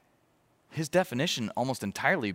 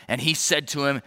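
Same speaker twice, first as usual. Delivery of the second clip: ordinary talk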